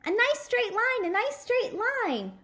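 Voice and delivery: high, loud chanted echo; kids shouting back. Acted. The class to the daddy, a loud chanted echo. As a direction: echo it back at full voice like schoolkids.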